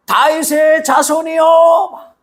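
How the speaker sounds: high, slow, drawn-out shout to be heard; loud cry. Retold or imitated. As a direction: cry out to Jesus with all your voice, long and high. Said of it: "이렇게 크게".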